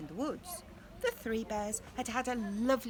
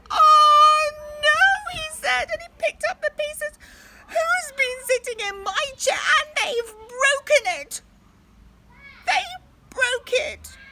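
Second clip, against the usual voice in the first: very high, loud crying shout; high sobbing bear yell; Baby Bear wailing; 16 dB louder than usual. Acted. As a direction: wail it like a heartbroken cub. Baby Bear's broken chair.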